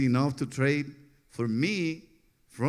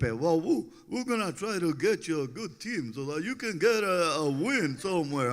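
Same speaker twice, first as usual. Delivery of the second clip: lightly voiced Felipe, a bit higher; a hint of the manager's voice, raised pitch; imitating Felipe; 5 semitones higher than usual. Pedro quoting manager Felipe, a light imitation. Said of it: "It's Felipe. That's how he talks".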